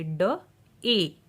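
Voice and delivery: ड, then a long ए, said apart; sounding out. Shown as a demonstration. Teacher showing AY words, sounding out ड, ए. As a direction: hold ए apart from ड. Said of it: "ए का long sound use".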